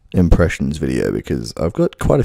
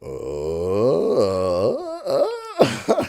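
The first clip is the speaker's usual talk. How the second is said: high cracking Shaggy voice, much higher; finding the Shaggy crack; 5 semitones higher, 4 dB quieter, much slower than usual. Shown as a demonstration. Practising Shaggy's voice on camera, high cracking voice. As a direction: voice up in the falsetto crack. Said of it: "Let's find out".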